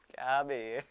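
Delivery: a lightly voiced amused scoff; amused Modi aside. Modi laughing at Japan, low mocking scoff. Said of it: "सभी हंस रहे होंगे कि".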